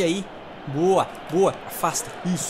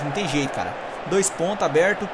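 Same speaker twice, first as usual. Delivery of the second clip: normal talking voice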